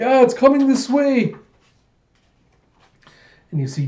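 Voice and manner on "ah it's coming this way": high, loud panicked scream; screaming soldiers. Acted. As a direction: yell a terrified warning. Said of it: "more soldiers screaming".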